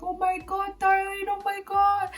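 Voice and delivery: high-pitched, fussing concern, acted out; alarmed sympathy. Lawyer fusses over sobbing intern, acted alarmed sympathy. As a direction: coo in high-voiced dismay over someone crying. Lawyer reacts to her crying.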